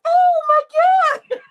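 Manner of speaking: drawn-out stunned cry; a crowd gasping at a punch; shocked gasp. Retold or imitated. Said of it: "we were all like".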